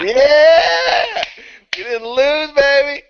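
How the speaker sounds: loud, high victory yell; excited shout. Real, not acted. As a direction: cheer it out loud. Celebrating the win, shouting.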